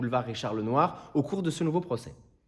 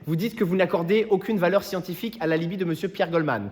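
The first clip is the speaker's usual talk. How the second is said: normal talking voice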